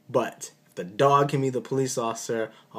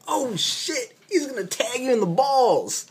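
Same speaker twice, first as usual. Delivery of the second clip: much higher, startled warning; alarmed high yelp; 13 semitones higher than usual. Retold or imitated. Doctor warning a nurse of a punch, shocked high cry. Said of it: "I was like".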